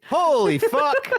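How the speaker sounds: slow, high startled swear. Real, not acted. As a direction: jump at the fright. Startled by sudden scare.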